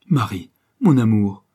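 loud call to a beloved; impassioned lover's cry. A narrator's reading. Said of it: "cria-t-il".

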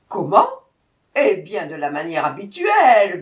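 loud, exasperated answer; impatient tiger. A narrator's reading. Tiger answering the jackal's silly question, impatient. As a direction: snap back loudly.

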